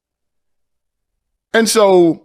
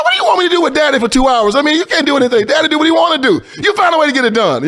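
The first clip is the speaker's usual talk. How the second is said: higher and fast, incredulous pushback at sisters; exasperated protest; 9 semitones higher, faster than usual. Retold or imitated. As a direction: toss it back at them in disbelief.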